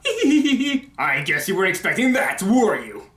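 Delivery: high, slow, giddy villain taunt; Doctor Eggman. Retold or imitated. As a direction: gloat theatrically through a giddy giggle. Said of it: "He even has this giddy laugh".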